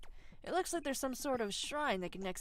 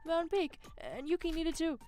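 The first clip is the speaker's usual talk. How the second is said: high, loud start to a defensive excuse; flustered protest; 6 semitones higher, 5 dB louder than usual. Acted. Shinji excusing himself to Sora, flustered high protest. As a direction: protest in a raised pitch.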